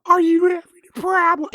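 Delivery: high-pitched cartoon character voice; Carl. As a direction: attempt a high, silly impression. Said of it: "I can't do the voice".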